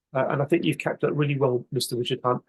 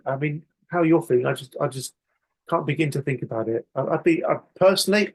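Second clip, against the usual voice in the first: usual speaking voice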